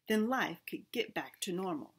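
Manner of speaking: ordinary delivery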